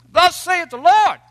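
high, loud, pompous proclamation; booming King James prophet. Retold or imitated. Preacher mocking a self-important prophet, booming loud. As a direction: thunder it like an Old Testament prophet. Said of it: "the angry guy who's screaming at us in King James English".

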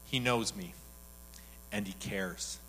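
low, hushed, moved delivery; retold tearfulness. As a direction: lightly imitate someone moved to tears, softly. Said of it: "Back to the crying, right?".

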